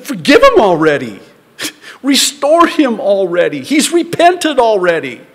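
loud, high exasperated rebuke. Retold or imitated. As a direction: shout it like Paul scolding a stubborn church. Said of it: "Paul has to rebuke them".

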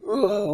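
high, loud groan of collapse, retold; a burst of despair as she falls to her knees; retold anguished groan. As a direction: let out a sudden pained ugh. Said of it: "And I was like".

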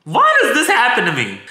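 high-pitched lament; exasperated wail. Acted. Woman lamenting what keeps happening to her, exasperated.

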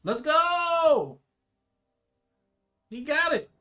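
loud, high celebratory yell; cheering shout. Real, not acted. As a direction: cheer the hero on. Cheering the hero's success.

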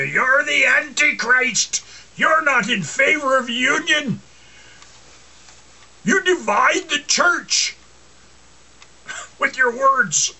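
high, slow, finger-wagging scold; scolding church woman. Retold or imitated. Preacher mimics scripture-quoting women scolding him, high slow and accusing. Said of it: "women quoting scripture and wagging their finger".

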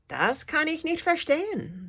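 the little cricket, puzzled, piping up high; tiny high insect voice shaking its head. Acted. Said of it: "sagt Hops".

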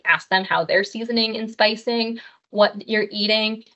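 ordinary talk, nothing marked

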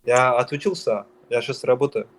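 usual speaking voice

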